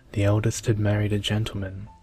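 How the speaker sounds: ordinary delivery, nothing marked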